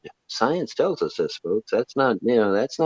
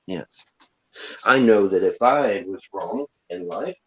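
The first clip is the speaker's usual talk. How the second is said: ordinary talk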